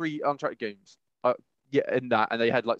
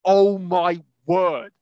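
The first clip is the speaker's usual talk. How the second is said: slow, louder, higher stunned gasp of disbelief; imitating shock; 4 semitones higher, 6 dB louder, much slower than usual. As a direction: drag it out like you just saw something awful. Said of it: "just, like, in shock of what happened".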